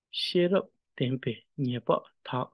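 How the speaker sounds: "She-rap, ten-pe, nye-pa, ta" in word groups; syllables paired into words, "She rap, ten pe, nye pa, ta"; word reading. Shown as a demonstration. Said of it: "to syllable to word and then to line".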